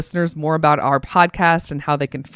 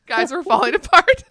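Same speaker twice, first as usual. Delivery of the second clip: laughing; 10 semitones higher, 4 dB louder than usual. Real, not acted. Both cracking up.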